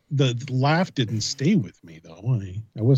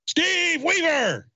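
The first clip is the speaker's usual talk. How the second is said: very high, drawn-out mock call; much slower than usual. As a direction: holler the name, stretched and high. Calling out on a voicemail.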